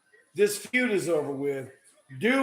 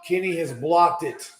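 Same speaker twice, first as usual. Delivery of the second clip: wrestling commentator; 4 dB louder than usual. Real, not acted. Live play-by-play.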